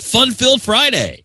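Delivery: high, hammy DJ announcer; cheesy radio voice. Pretend. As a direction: pitch it like a radio promo. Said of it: "sounds so friggin' radio cheese".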